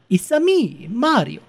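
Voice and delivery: high, hammed-up Italian accent; Mario. Host attempting the game hero's ethnic slant, exaggerated Italian accent. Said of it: "attempting that severely racist".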